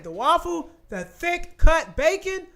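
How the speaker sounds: ordinary delivery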